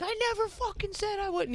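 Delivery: very high-pitched mock screech; retold screaming. Woman retelling screaming at boyfriend, high mock scream. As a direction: imitate a shrieked denial without full volume. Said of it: "I'm screaming at Ian".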